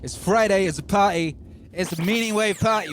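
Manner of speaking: loud, upbeat party host; hype host intro. Acted. Streamer opening his Friday show to viewers, hype host. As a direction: pump up the crowd.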